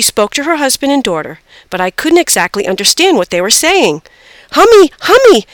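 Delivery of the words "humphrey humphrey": put-on high, loud toddler cry; little toddler calling. A narrator's reading. Toddler brother calls to greet the hamster, high little voice. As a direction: pipe up loudly like a tiny boy. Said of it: "a little voice called out".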